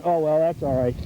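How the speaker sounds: usual speaking voice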